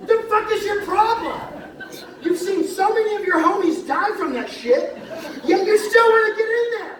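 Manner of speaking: loud, high yelling with laughter breaking through; shouted at the bee. Retold or imitated. Yelling at a bee that keeps diving into the can. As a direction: yell it at the bee in exasperated disbelief. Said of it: "finally I go".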